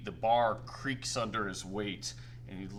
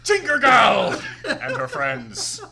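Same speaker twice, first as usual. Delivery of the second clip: announcer voice; 13 semitones higher, 11 dB louder than usual. Acted. Fat man introduces the contestants, loud ringmaster call. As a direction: boom out the matchup, laughing. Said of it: "in this deep baritone voice".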